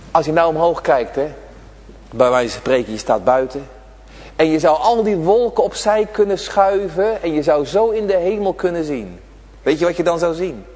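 normal talking voice

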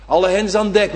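ordinary talk, nothing marked

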